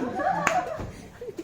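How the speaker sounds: high burst of laughter; loud laugh. Real, not acted. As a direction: break into laughter. Laughs after the quote.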